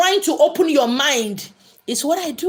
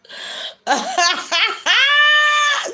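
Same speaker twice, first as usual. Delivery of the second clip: high, shrieking belly laugh; real loud laughing fit. Real, not acted. Live host cracking up at a viewer's story, laughing. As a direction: burst out into a long, high cackle. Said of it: "You were laughing at me".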